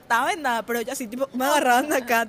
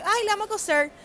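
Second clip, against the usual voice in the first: imitated cheerful doctor; 9 semitones higher, 6 dB louder than usual. Doctor's casual line.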